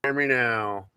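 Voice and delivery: ordinary talk, nothing marked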